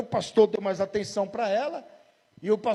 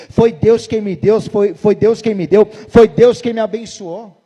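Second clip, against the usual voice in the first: loud, slow, fervent declaration of blessing; joyful proclamation; 11 dB louder, slower than usual. Acted. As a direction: proclaim it over your child like a preacher. Proclaiming a blessing over child. (proclamação fervorosa, alta e lenta)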